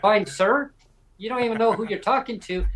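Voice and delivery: high, incredulous echo of a reply; mock-indignant echo. Premier mockingly echoes his reply.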